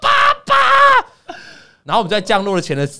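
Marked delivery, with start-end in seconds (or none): 0.0-1.4 put-on much higher, louder, slow wailing cry of a three-year-old; child crying loudly. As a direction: wail like a toddler who won't let go of dad. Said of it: "我女儿就哭得超大声". (高声拖长地模仿三岁女儿哭喊)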